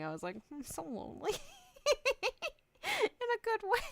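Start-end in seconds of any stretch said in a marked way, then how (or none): 0.5-1.3 high, soft mock-tearful whimper; self-mocking little sob; mock crying. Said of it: "I started crying". 1.3-3.9 very high, giggly aside; real laughter squeezing the voice high; laughing. Real, not acted. Laughing after mock-crying line.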